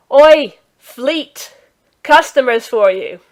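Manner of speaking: loud call across the stable. Acted. Striper calling the stable master over, loud. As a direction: shout it to someone at the back.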